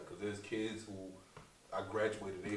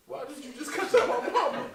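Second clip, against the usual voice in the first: much higher and louder, laughing through; 11 dB louder than usual. Real, not acted. Comedian asking the host again, laughing. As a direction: repeat the question laughing in disbelief.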